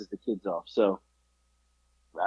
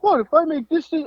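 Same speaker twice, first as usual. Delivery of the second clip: mock confused son. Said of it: "He's like".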